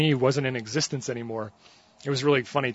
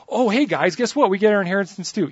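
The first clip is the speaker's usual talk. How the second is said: high, loud eager announcement; excited daughters; 7 semitones higher, 6 dB louder than usual. Retold or imitated. Daughters tell everyone of inheritance, high and excited. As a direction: burst out with the news. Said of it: "the daughters went out and said".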